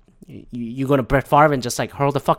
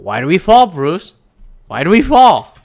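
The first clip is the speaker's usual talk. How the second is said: loud, higher quoted movie line; quoting the mentor; 3 semitones higher, 8 dB louder than usual. Retold or imitated. Quoting the movie mentor to his cohost, loud and high. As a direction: boom the question like a stern mentor. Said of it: "the quote that I really love so much".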